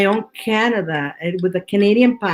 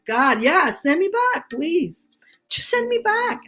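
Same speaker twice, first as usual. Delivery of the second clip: mock pleading; 9 semitones higher than usual. Pleading to be sent back.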